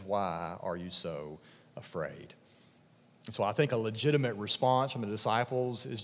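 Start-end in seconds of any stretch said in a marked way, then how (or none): 0.0-2.3 slow hushed question; soft rebuke. Acted. Jesus gently questioning his disciples, slow and hushed. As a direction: ask it gently, almost under the breath. Jesus quoted again, softly.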